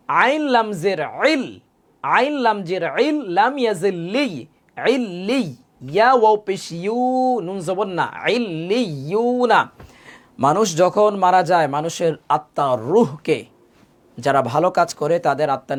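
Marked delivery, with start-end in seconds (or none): 0.1-9.7 slow, sing-song letter-by-letter chant; repeated spelling drill. Shown as a demonstration. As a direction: chant it through carefully, stretching লি and ইউ. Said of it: "আমি আবারও বলছি". (সুর করে টেনে বানান করে পড়া)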